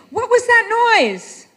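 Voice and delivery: high, loud cry at a sudden noise; startled shout. Shown as a demonstration. Innkeeper woken by something she heard, startled shout. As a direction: shout it as if jolted from sleep.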